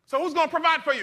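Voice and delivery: loud, high, building preacher's cry; preaching at full force. Acted. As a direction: preach it, lifting the congregation. Call and response with congregation.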